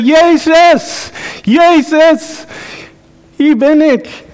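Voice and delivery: high, loud cry to Jesus; eager calling out. Retold or imitated. Peter swimming to Jesus, calling out loud and eager. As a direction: call out to him with longing joy. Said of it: "Hij zei".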